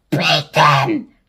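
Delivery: low loud bark; harsh cry. A narrator's reading. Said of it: "cried Aunt Sponge".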